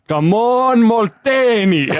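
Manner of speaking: high, drawn-out hammed-up heckler shout; mock fan yell. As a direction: bellow it like an old fan at the back. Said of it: "this guy was just yelling out".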